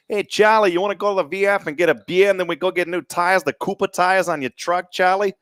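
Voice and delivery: high, hearty mock Connecticut accent; hammed-up Connecticut buddy, every r dropped; Mister Okie. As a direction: rattle off the invitation in a high Connecticut holler. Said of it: "Mister Okie impersonation".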